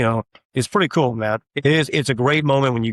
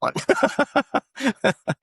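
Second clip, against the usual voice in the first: high laugh; 13 semitones higher than usual. Real, not acted. Laughing at his own joke.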